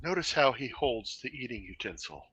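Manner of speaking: hushed nature documentary narrator; low, quiet wildlife narration. Pretend. Mock wildlife show about eating.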